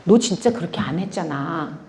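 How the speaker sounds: soft, low, retold divine reproof; gentle inner rebuke. The Holy Spirit to the weeping believer, quietly reproving. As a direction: drop low and softly correct. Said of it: "성령께서 감동하시는 게".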